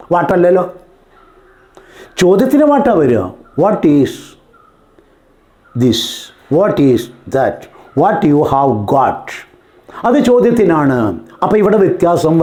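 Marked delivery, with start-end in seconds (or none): 3.6-9.5 slow, word by word example sentence; slow dictation. Shown as a demonstration. Teacher dictating an English example sentence to students, slowly. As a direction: spell out each word slowly for the class.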